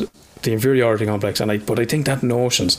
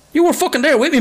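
a yelped protest, much higher; indignant high retort; 13 semitones higher than usual. Retold or imitated. Father hits back at his ex at breakfast, high and indignant. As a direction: shoot it up high in mock outrage.

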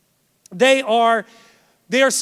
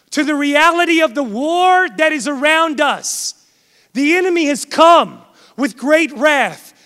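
emphatic warning, key words hit hard; forceful preaching; 4 semitones higher, 7 dB louder than usual. Real, not acted. Preacher warning the congregation of a war around them, urgent and forceful. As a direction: sound the alarm to a congregation.

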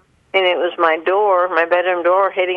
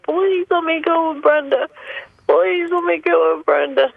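imitated sobbing plea; 10 semitones higher than usual. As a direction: re-enact a child's desperate begging, pitched way up. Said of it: "screamed and yelled at the top of my lungs, sobbing".

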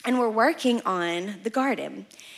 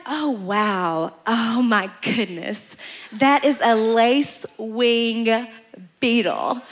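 admiring friend; much slower than usual. Retold or imitated. Voicing friend admiring a beetle.

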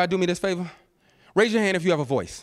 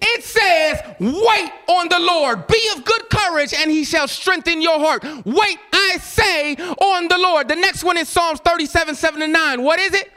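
preaching at full force; 6 semitones higher, slower than usual. Acted. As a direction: proclaim each verse like a preacher. Shouted scripture reading.

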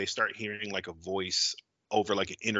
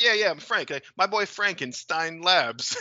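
cheerful Frank reply; 7 semitones higher, 6 dB louder than usual. Retold or imitated. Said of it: "be like".

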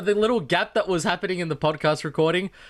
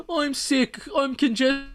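high-pitched mock complaint; Brit; 9 semitones higher, slower than usual. Host mimicking partner upset about the fan, high mock whine. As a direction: imitate an upset, congested partner in a raised voice. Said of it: "she always goes".

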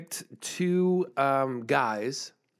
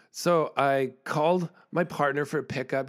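normal talking voice

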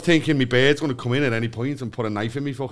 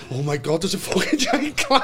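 mock panic; 5 semitones higher than usual. Acts out paranoid panic in car.

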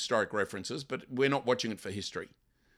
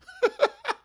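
high laugh; 8 dB louder than usual. Real, not acted. Laugh after mock voice.

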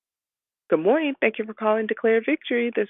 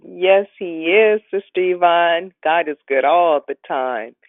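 loud and slow church response; loud praise response; 3 semitones lower, 8 dB louder, slower than usual. Real, not acted. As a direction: answer the praise call with full voice. Host answers praise call loudly.

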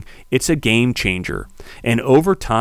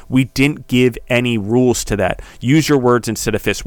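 ordinary delivery

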